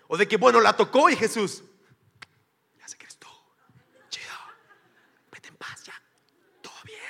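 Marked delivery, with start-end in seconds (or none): 2.8-7.0 unvoiced, secretive whisper; mock whisper. Jesus secretly telling the healed woman it's fine, whispered. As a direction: whisper it so nobody else hears.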